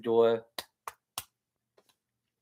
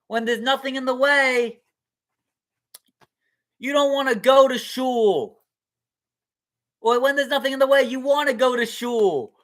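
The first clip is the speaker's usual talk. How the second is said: much higher, louder eureka rabbi; triumphant rabbi; 10 semitones higher, 9 dB louder than usual. Acted. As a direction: crow it like a sage who found the lesson.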